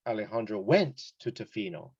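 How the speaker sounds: "went" punched out over the other words; stress on WENT. Shown as a demonstration. Teacher showing the past tense matters, "went" emphasized. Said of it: "I'm emphasizing went".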